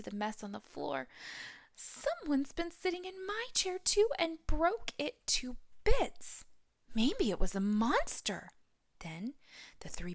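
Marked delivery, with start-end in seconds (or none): 1.8-8.5 put-on high, squeaky-young little cub voice; Little Wee Bear. Little cub tells his family his chair is broken, high.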